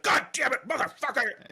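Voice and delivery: imitating dad swearing from the ladder, high-pitched; mock enraged cursing. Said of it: "he yells at me about it".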